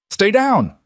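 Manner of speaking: high, loud urgent order; shouting. Acted. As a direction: yell it over the gunfire. Shouting during gunfire.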